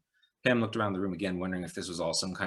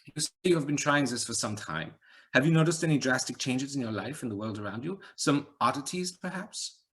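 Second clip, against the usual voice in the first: higher rhetorical musing with a hint of accent; light Eastern European accent; 6 semitones higher than usual. A narrator's reading. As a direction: lecture her on the nature of things. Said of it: "slight accent, Eastern European".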